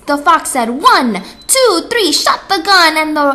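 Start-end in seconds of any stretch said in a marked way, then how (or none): 0.7-2.9 race starter count. Acted.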